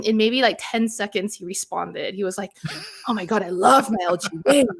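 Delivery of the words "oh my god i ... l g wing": lightly voiced colleague declaring his love; imitated colleague. Host quotes colleague praising his phone, light imitation. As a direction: quote an enthusiast with a slightly different timbre. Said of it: "so Richard was like".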